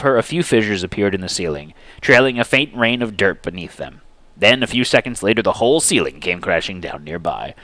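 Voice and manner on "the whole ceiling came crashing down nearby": usual speaking voice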